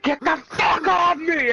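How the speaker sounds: high, loud, drawn-out protest; imitated angry yell. Body-slammed kid to his attacker, yelled protest. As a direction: act out the pinned kid yelling. Slammed classmate yelling in retelling.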